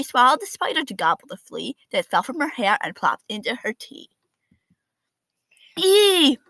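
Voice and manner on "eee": shocked squeal. Acted. Kids see Jake swallowed.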